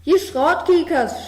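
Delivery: the farmer curses the frogs, loud and high; scolding farmer. A narrator's reading. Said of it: "schimpft die Bur". (lautes, hohes Schimpfen)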